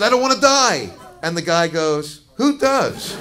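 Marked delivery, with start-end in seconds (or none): 0.0-0.9 scared plea. Retold or imitated. Scared patient pleading on table. 2.4-3.1 glib doctor. Retold or imitated. Doctor's glib reply retold.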